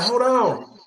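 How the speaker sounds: high, falling call for a pause; imitated plea for pause. Winded student stalling her attacker, imitated high plea. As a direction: ask high for a moment's wait.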